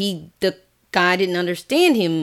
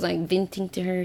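ordinary talk, nothing marked

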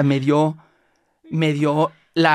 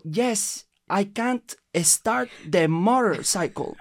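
higher, slow, over-careful tourist English; slow pleading English; 4 semitones higher, much slower than usual. Retold or imitated. Stranded tourist pleading slowly with the Thai woman. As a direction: spell it out slowly for a foreigner.

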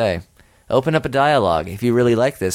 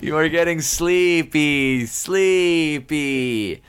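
slow, drawn-out hypnotist chant, pitched up; hammed-up hypnosis voice; mock hypnotist; 5 semitones higher, much slower than usual. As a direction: stretch out each word like a stage hypnotist. Plays a hypnotist in the roleplay.

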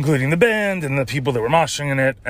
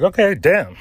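high, loud letdown; a disappointed "okay, damn"; deflated okay damn; 7 semitones higher, 4 dB louder than usual. Retold or imitated. Reacting to promoter.

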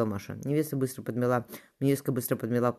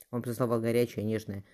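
usual speaking voice

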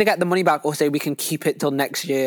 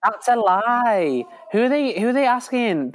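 drawn-out, pitched-up disbelief; slow, higher scoff at the number; incredulous; 7 semitones higher than usual. Real, not acted. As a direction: stretch it out like you refuse to believe it. Friend disputes the statistic.

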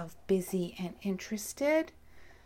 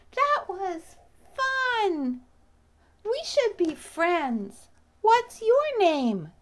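high, excited little bee voice; friendly bee; 8 semitones higher, 6 dB louder, slower than usual. Acted. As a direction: gush like a delighted bee making friends. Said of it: "the bee asked little Theo".

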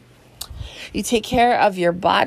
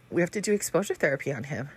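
softer, quick retold plan; hushed, hurried quote; hushed aside; 8 dB quieter, faster than usual. Retelling her plan to husband.

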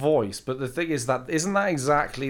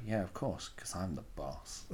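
lower, much softer mock swagger; mock smug boss; 7 semitones lower, 16 dB quieter than usual. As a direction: play the big man quietly. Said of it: "I go".

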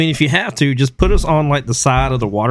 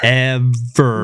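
drawn-out echoing movie quote; Sandlot imitation. Co-host trading Sandlot echoes, drawn-out. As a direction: stretch it out as an echo. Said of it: "pulling out the Sandlot".

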